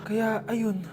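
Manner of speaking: low, soft grim reveal; grave quiet. Acted. Doctor telling Susan her children are dead, hushed. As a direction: break the sad truth softly.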